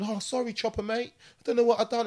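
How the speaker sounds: apologetic stabber. Retold or imitated. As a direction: play the confused attacker begging forgiveness. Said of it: "starts going".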